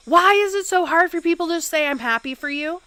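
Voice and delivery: high, loud frustrated outburst; exasperated. Retold or imitated. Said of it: "And I was like".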